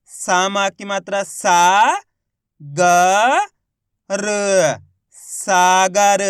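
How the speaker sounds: long सा, ग, र, then सागर stretched; slow spelling into a drawn-out सागर; letter by letter. Shown as a demonstration. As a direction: sound out each letter, then blend slowly. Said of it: "एक अक्षर का उच्चारण करके".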